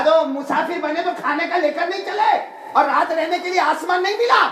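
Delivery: high, loud, scolding retort; angry. Retold or imitated. Butcher scolding a traveller who asks for food and shelter, angry. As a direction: throw it back loudly in irritation. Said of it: "वो तो पूरा दिन गुस्से में".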